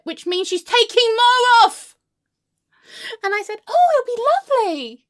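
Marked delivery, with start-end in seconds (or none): 0.5-2.0 loud, high alarm; horrified outburst. Acted. Explaining what texturizing really means, horrified outburst. Horror at more cutting. 3.7-5.1 high, loud, swooping gush; falsely cheery politeness. Acted. Client assuring hairdresser she can texturize, falsely cheery politeness.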